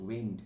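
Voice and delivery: low "উইন্ড" with the vowel ai; ai sound. Shown as a demonstration. Teacher confirming the close up pronunciation, ai vowel. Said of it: "এআই উচ্চারণ হচ্ছে".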